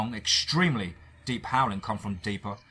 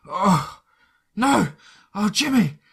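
high, loud anguished wheeze; wheezing; 10 semitones higher, 8 dB louder, slower than usual. Acted. Dan remembering Jimmy, high loud anguished wheeze. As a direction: cry out in horror as it comes back. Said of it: "I wheezed".

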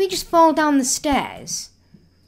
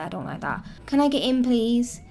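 ordinary talk, nothing marked

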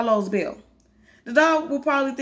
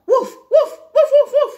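high, loud, sharp woofs; regular dog bark; 9 dB louder than usual. Acted. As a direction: bark like an excited ordinary dog. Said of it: "My first dog sounded regular".

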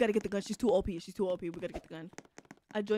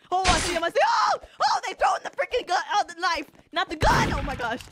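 high, loud startled yelling; real jumpy gamer panic; panicked excitement; 7 semitones higher, 7 dB louder than usual. Real, not acted. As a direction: shout in a rush of alarm. Panicking as knives are thrown.

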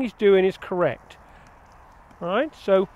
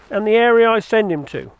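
usual speaking voice

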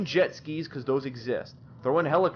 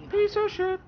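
"Piece of shit" repeated in a much higher mocking falsetto; "Piece of shit" echoed as a much higher cartoon squeak; squeaky mock echo. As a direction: parrot "Piece of shit" back in a silly squeak. Mocking echo of his complaint.

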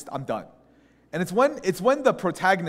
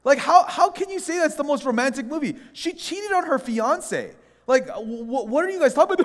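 high, exasperated arguing; incredulous objection; 10 semitones higher, 4 dB louder than usual. Retold or imitated. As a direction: challenge friends in mock outrage. Acted argument with friends.

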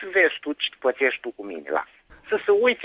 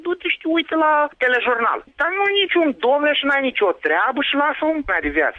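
angry shout; 8 semitones higher than usual. Real, not acted. Neighbour defending his home, shouting angrily. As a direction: fire it back, outraged.

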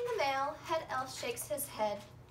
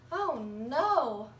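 loud startled cry; dismayed oh no; 6 dB louder than usual. Acted. Santa hears children spotted him, loud dismay. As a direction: gasp it out, caught red-handed.

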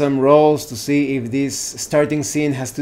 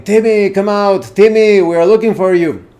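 raised, higher searching call; 5 semitones higher, 6 dB louder than usual. Acted. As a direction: call out loudly for a missing child. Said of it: "he starts yelling".

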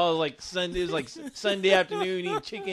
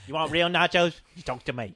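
hammed-up Aussie salesman; Australian accent; 3 semitones lower than usual. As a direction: pitch it in a put-on Australian voice. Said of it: "I'm doing an Australian accent".

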